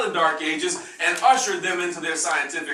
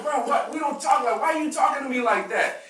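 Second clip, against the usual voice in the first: puzzled brother; 8 semitones higher than usual. Retold or imitated.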